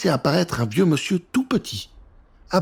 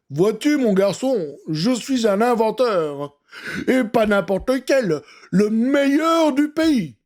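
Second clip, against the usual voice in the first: put-on higher, slow, boastful old man; proud old inventor; 5 semitones higher, slower than usual. Professor boasting to young Léo, slow proud old voice. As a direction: puff up and crown himself the best.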